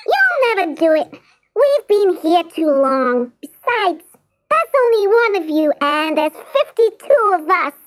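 high squeaky mouse, boastful; cocky mouse. Acted. Jiggs boasting to the cat, high and squeaky. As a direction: crow it like a cheeky mouse.

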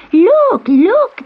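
put-on high, loud excited children's call; children shouting. A narrator's reading. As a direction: call out to the others, pointing. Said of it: "they shouted".

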